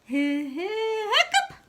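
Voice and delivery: high rising cartoon hiccup; hammed-up hiccup. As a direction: squeak it up into a hiccup. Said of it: "It's hard to say those words".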